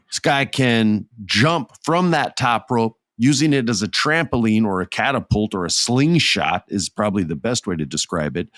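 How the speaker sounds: normal talking voice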